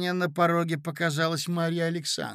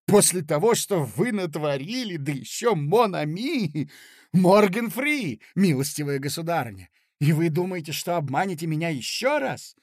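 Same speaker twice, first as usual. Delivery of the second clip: furious rant; 3 semitones higher, 5 dB louder than usual. Acted. Furious reply continues.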